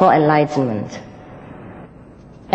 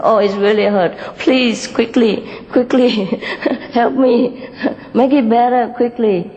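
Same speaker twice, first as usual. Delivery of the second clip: higher, whimpering pleas for help; mock crying plea; 5 semitones higher than usual. Hurt red begs green for medicine, in a higher, half-crying plea. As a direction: beg through pretend tears.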